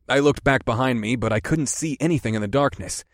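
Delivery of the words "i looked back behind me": plain reading voice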